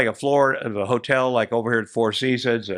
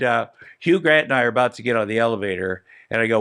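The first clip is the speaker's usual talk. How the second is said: usual speaking voice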